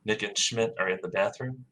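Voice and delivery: normal talking voice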